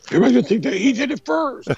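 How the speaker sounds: high, quick mimicked complaint; mock exasperation. Retelling a star's reaction to the parody, high mock exasperation. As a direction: put on a high, rushed voice for a retold complaint. Retold reaction to being copied.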